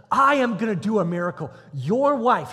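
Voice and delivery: loud, high, forceful declaration; emphatic proclamation. Acted. God promises Abraham a miracle, emphatic proclamation. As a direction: announce it with total authority.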